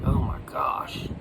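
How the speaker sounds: slow, whispered mock exasperation; exasperated. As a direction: groan it under the breath like an eye roll. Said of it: "face palm or rolling his eyes".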